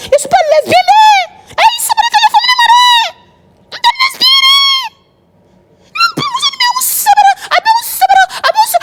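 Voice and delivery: very high-pitched wailing shout; piercing, exasperated outcry; shrieked protest. Real, not acted. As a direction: shriek the denial at the top of your pitch. Outburst at woman's advances.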